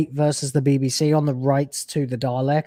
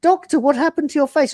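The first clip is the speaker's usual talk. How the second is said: much higher, mock panicked companion; freaking-out assistant; 12 semitones higher, 4 dB louder than usual. Assistant panics at the Doctor's new face, mock high. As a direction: shriek it as a startled companion. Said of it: "the assistant is freaking out".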